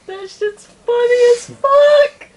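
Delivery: high, slow, laughing loudly; cracking up while saying it. Real, not acted. Laughing at a joke.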